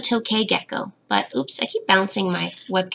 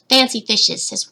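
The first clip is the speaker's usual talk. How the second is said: ordinary talk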